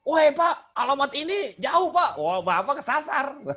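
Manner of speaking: high, loud hollering; high-toned, confrontational reply. Acted. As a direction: answer as if picking a fight. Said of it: "jawabnya makin nada tinggi".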